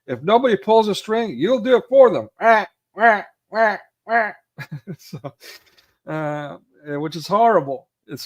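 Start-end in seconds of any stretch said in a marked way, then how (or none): 0.1-2.3 high, loud mocking berate; ripping into someone. John Candy's travel partner berating him, high loud scorn. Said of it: "like, ripping into him". 2.4-4.3 high, loud whiny wah-wah; mock him like a crybaby; mock crying wah. Mocking the stranger as a crybaby, high whiny wah.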